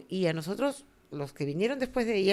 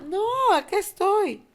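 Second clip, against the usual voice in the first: much higher promise; mock reassuring mother; 12 semitones higher, 4 dB louder than usual. Mother assuring the girl she stays, much higher. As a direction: fake calm.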